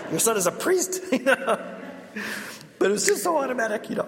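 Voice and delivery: laughing while talking. Real, not acted. Priest amused by his mother's answer, speaking through laughter. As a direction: talk through a real laugh.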